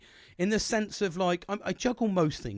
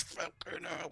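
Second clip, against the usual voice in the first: breathed-out, barely voiced curse; hushed rueful swear; muttered aside; 13 dB quieter, slower than usual. Real, not acted. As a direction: drop it under your breath.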